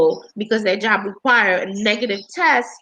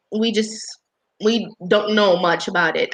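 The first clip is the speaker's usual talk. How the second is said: ordinary delivery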